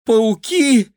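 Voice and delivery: high, loud horrified squeak. A narrator's reading. Kira learns of spiders, frightened high squeak. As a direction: recoil at the word in fright.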